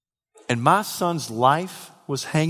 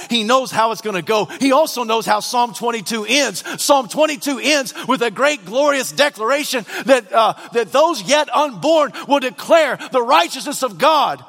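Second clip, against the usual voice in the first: raised, driving pulpit delivery; fervent preaching; 8 semitones higher, 3 dB louder than usual. Real, not acted. Preacher telling sinners of God's love, fervent preaching. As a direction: press each phrase home to the congregation.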